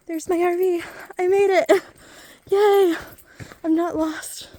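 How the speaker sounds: high, loud, relieved cheer; overjoyed to have made it back; excited relief. Real, not acted. Finds RV, relieved after walk.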